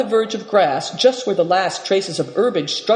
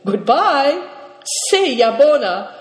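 raised, higher farewell call; calling goodbye; 8 semitones higher, 3 dB louder than usual. A narrator's reading. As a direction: call out a goodbye after riders. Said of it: "cried the Marin".

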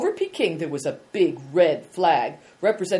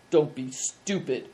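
growled; 2 semitones lower than usual. A narrator's reading. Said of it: "growled the man".

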